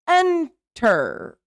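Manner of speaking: ENTER broken apart, EN, pause, TER; split into syllables. Shown as a demonstration. Teacher counts syllables, "enter" split as en - ter. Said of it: "two syllables".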